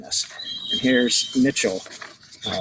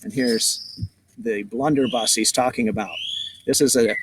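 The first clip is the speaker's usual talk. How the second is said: plain reading voice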